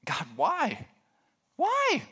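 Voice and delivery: high-pitched acted wail of complaint; anguished pleading. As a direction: mock-desperate prayer leaping very high. Acts out pleading prayer to God.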